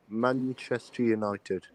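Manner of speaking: ordinary delivery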